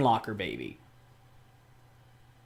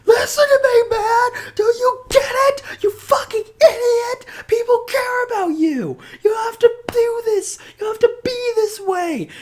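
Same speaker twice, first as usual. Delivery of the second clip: very high, loud, sobbing yell; hammed-up Ichiban wailing through tears; imitating a hero screaming and crying at his friend; mock crying scream; 5 dB louder than usual. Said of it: "crying and screaming".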